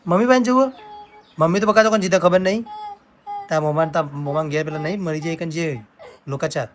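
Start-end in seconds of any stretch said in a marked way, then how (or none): 0.1-2.6 high, loud questioning of a little one. Real, not acted. Parent to crying child about going to mummy, high and loud.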